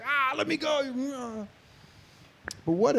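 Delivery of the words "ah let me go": high, soft, slow anguished cry; pained cry. Shown as a demonstration. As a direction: let it go with pain. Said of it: "This is sort of like".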